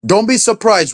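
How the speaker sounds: ordinary talk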